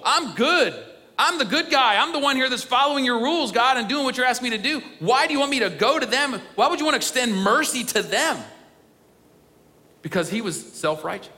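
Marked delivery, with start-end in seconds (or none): 0.1-8.4 indignant protest. Acted. Jonah protesting to God about the Ninevites, indignant and higher. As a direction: complain to God like the wronged good guy.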